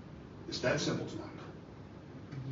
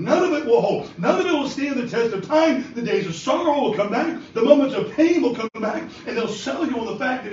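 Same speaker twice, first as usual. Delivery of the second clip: higher, louder preaching that builds; full-force preaching; 5 semitones higher, 4 dB louder than usual. Acted. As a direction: drive it home to the congregation.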